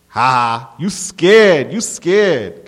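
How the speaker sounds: loud, high bully's gloating; jeering taunt. A narrator's reading. Bully mocking the narrator who just flinched, jeering loudly. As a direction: crow mockingly over his flinch.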